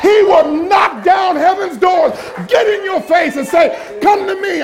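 preaching at full force. Real, not acted. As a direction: preach it big to the room.

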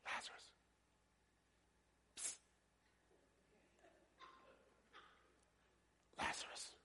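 barely audible whispered call; mock whisper. Preacher showing how Jesus didn't call Lazarus, whispered. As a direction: whisper secretly to someone, with a psst. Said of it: "He didn't do that".

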